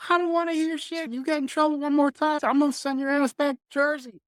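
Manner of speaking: very high mock coach rant; angry coach. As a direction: parody a coach chewing out a player. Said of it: "Coach Fry's like".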